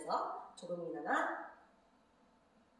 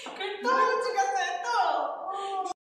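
excited teasing; 10 semitones higher, 7 dB louder than usual. Real, not acted. Teasing partner's showy move.

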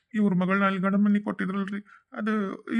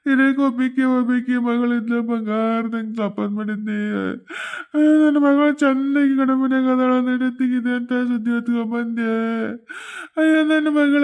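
acted wailing; 3 dB louder than usual. Mother lamenting her only daughter's death, wailing. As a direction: wail out each phrase in mock mourning for the drama.

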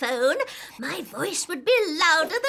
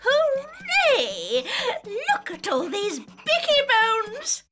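delighted cheer; 7 semitones higher, slower than usual. Acted. Wins contest, delighted cheer.

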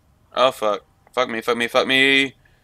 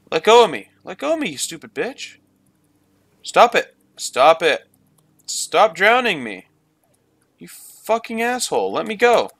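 high-pitched, playful yelling at an attacker; half-serious angry pleading; exasperated protest; 6 semitones higher, 3 dB louder than usual. Pretend. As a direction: protest in mock outrage, pitch jumping up. Yells at mermaid drowning his character.

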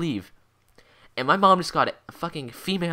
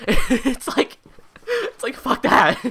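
laughing through the words, pitched high; 12 semitones higher, much slower than usual. Real, not acted. Teen laughing at his own rant about the alleyway, giggling. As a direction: chuckle it out, amused at himself.

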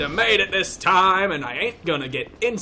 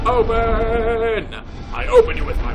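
mock command; 7 semitones higher, 5 dB louder than usual. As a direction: call out a magic command to the door. Commands hidden door to open.

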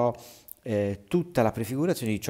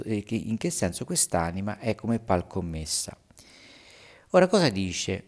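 usual speaking voice

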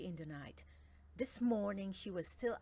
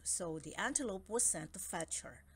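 ordinary delivery, nothing marked

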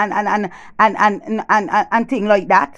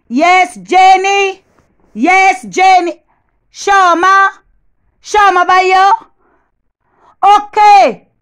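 high, drawn-out holler; loud calling out; 9 semitones higher, 7 dB louder, much slower than usual. Acted. Woman shouting into the phone for the neighbour's ears, loud holler. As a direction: holler each name so the neighbour hears.

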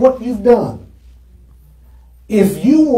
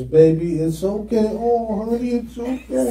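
soft, comforting reassurance; soothing wife; 7 dB quieter than usual. Retold or imitated. Preacher imitating his wife, softly soothing him. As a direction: gently console a crying husband. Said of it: "my wife is".

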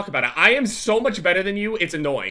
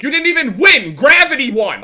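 loud, high, emphatic rebuttal; indignant yell; 7 semitones higher, 9 dB louder than usual. Real, not acted. Gamer denying the opponent's win, indignant yell. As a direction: throw it back at the winner angrily.

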